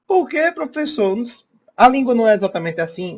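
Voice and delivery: high, eager student voice; student's question. Pretend. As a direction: play the puzzled pupil asking the teacher. (imitando um aluno curioso, voz bem mais aguda)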